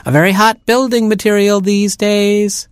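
high sales patter; seal voice. A narrator's reading. Seal pitching coal to the youngest penguin, high sales patter. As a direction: pitch it like a slick dealer. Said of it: "he cried".

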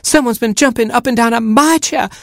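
outraged hen finding her chair broken; flabbergasted hen. Acted. Mother hen discovering her broken chair, flabbergasted. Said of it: "she clucked, flabbergasted".

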